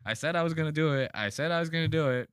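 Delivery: high, fast, gleeful repetition; mock triumph. Streamer voicing the boxer who sees he's won, fast mock triumph. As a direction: imitate a boxer excitedly vindicated, rattling it off.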